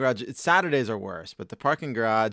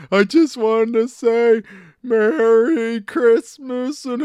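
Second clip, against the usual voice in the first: put-on high, loud, slow old wrestler voice; Terry Funk; 12 semitones higher, 10 dB louder, much slower than usual. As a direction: bellow it slowly like a hardcore wrestler. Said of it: "asking me to do Terry Funk".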